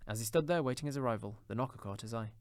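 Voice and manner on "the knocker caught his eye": ordinary delivery, nothing marked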